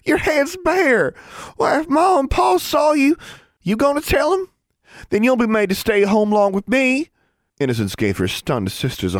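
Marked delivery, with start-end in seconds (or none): 0.1-7.1 scolding Ozark sister. A narrator's reading.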